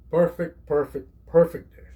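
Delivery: each PERFECT punched loud, set apart by pauses; loud, insistent stress on PERFECT; emphatic. Real, not acted. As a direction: hammer the word perfect. Boasting about the dish.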